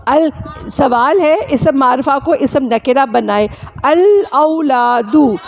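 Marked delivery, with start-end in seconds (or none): 3.8-5.3 "الاولادو" drawn out slowly and called out; slow emphatic read. Shown as a demonstration. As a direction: stretch "الاولادو" for the class. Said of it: "آخر میں ہم نے نن".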